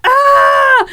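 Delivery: high loud cry of pain; retold scream. Recalling the leg cramp, sharp high shout. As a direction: yelp as the cramp hits. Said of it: "I think I was even going like".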